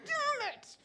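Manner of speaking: high, squeezed mock cursing; imitating a silent inner tantrum, very high; inward frustration. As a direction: squeal it out in pent-up frustration. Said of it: "inside I'm like".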